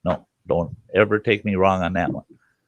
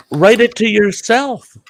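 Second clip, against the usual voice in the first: high, loud slow sass; defiant retort; 13 semitones higher, 5 dB louder than usual. Retold or imitated. To the cop writing the ticket, a slow defiant retort. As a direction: drag it out defiantly.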